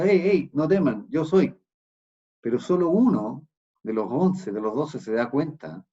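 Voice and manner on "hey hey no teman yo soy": loud, raised call across the water; Jesus calling to the boat over the storm. Acted. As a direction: call out to reassure them.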